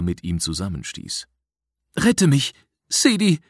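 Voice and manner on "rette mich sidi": high, loud frightened plea; pleading boy. A narrator's reading. Boy begging his rescuer to save him, pleading. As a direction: plead urgently in a raised boy's voice. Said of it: "flehte der Knabe".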